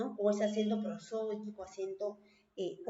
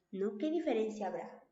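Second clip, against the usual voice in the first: ordinary talk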